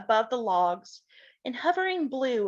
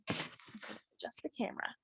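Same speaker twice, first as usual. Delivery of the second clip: usual speaking voice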